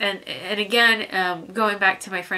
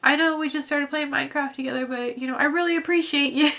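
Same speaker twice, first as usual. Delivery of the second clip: higher, eager, overly earnest; awkward gushing friend; 6 semitones higher than usual. Retold or imitated. Imitating a weird person thanking a new Minecraft friend, higher and eager. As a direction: play the weird friend who overshares. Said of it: "that weird person that's like".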